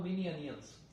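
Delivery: "मिनी अनियंस" softly with a y glide between the words; linking y sound. Shown as a demonstration. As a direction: slide "मिनी" into "अनियंस" through a y. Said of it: "जैसे मैं बोल के सुना रहा हूँ". ("मिनी अनियंस" में बीच में हल्का य जोड़ें)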